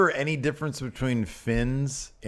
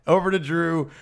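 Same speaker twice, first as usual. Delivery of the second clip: high-pitched, incredulous complaint; laughing at first, then high and exasperated; amused exasperation; 8 semitones higher than usual. Real, not acted. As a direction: protest it in a rising, disbelieving voice. Amused complaint about missing camera shot.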